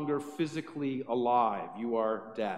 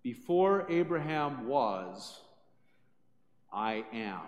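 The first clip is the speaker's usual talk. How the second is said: slow, lowered, weighty declaration; bold claim; 5 semitones lower, slower than usual. Retold or imitated. Jesus making his final claim to the crowd, slow and weighty. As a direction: let each word land deliberately. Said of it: "finally and boldly claims".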